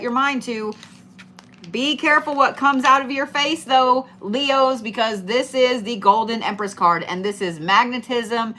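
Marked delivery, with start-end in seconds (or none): none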